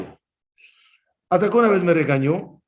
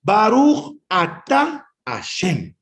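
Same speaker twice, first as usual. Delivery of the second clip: prayer recited aloud; 8 semitones higher, 5 dB louder, much slower than usual. Retold or imitated. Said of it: "le abro el Sidur".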